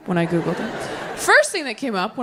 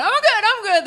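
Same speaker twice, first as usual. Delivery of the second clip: much higher, breathless insisting; frantic denial; 13 semitones higher than usual. Acted. Drowning swimmer waving off help, high panicky denial. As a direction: squeak out that you're totally fine.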